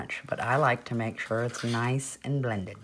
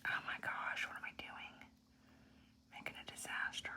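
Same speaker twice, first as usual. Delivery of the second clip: hushed whisper of dismay; leaning in to whisper; 16 dB quieter than usual. Real, not acted. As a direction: whisper it to yourself, startled. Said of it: "I'm very sorry I whisper".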